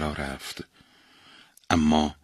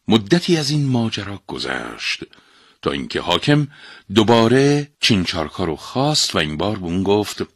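ordinary delivery

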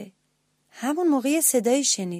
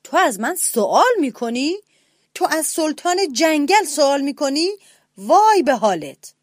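loud, higher furious roar; lion roaring; 5 semitones higher, 6 dB louder than usual. A narrator's reading. Lion roaring at the mouse who dared ask. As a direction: threaten like an outraged king. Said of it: "شیر دوباره نعره زد".

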